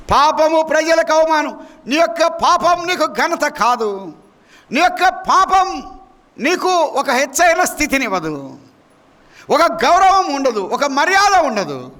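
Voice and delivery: high, slow, emphatic pulpit warning; full-force preaching. Real, not acted.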